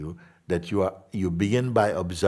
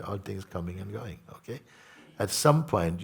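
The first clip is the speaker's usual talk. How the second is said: ordinary talk, nothing marked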